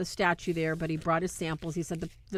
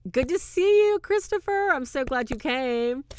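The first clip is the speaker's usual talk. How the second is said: sweet greeting; 7 semitones higher, 6 dB louder than usual. Retold or imitated. Retold movie greeting.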